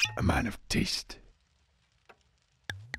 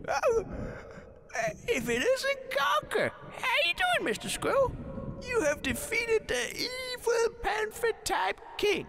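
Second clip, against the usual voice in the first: mocking villain greeting; 12 semitones higher than usual. Villain greeting the squirrel king, high mocking shout. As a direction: taunt the hero with a shrill shout.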